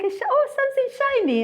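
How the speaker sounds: mock getting distracted mid-sentence in a high voice; playful high-pitched distraction; easily distracted. Acts out her own distracted self.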